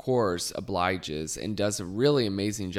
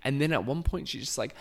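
ordinary delivery

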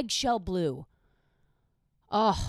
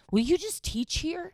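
admiring teacher; 10 semitones higher than usual. Retold or imitated. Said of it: "they'd go".

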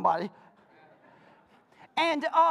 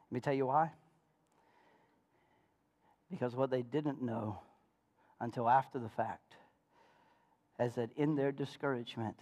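quiet reflective preaching; 10 semitones lower, 6 dB quieter than usual. Real, not acted. Preacher hushing his congregation, lower and softer. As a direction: draw them in close and soft.

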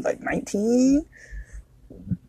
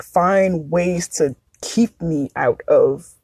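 ordinary delivery

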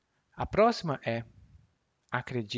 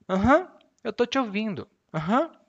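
higher, brighter Aham of yes I hear you; eager agreement; 8 semitones higher, 6 dB louder than usual. Shown as a demonstration. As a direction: answer with a lively Aham. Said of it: "confirmando demo".

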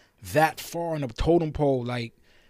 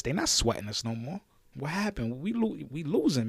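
ordinary talk

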